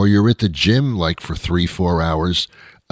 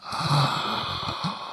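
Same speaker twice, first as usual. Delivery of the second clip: mock gasp; 8 semitones higher, 9 dB quieter than usual. Gasp before revelation.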